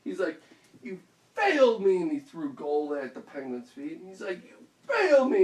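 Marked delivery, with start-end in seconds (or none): 0.8-2.0 loud, high burst on "failed"; mock-furious outburst; acted anger. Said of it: "he was so mad". 4.4-5.4 high, loud accusing shout; mock-furious yell; acted anger. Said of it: "he was so mad".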